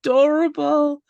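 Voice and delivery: high-pitched adoring coo; real gushing. Real, not acted. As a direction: melt over something adorable, voice way up. Gushing over the adorable baby dragon.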